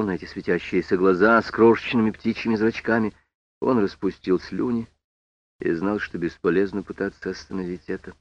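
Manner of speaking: ordinary delivery, nothing marked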